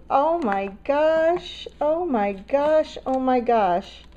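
high, slow, stretched-out exclamations; real astonishment; overwhelmed amazement. Real, not acted. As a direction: draw out each word in disbelief. Overwhelmed by tea selection.

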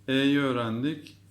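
normal talking voice

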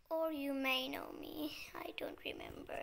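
soft breathy whisper. Real, not acted. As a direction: hush your voice. Sister asleep nearby.

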